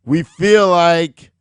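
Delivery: we feel like stretched very slowly and loud; drawn-out suspense. Retold or imitated. Boss building suspense before the news, slow and loud. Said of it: "here comes the punchline".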